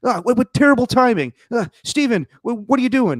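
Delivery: high, loud panicked outburst; high, loud mock panic; frantic. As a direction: react to the breaking news in a panic. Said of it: "I was like".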